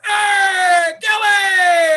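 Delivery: loud, high call across the street. Retold or imitated. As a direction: shout his name from afar. Cop calling across the street.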